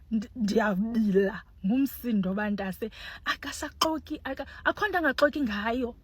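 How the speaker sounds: ordinary talk, nothing marked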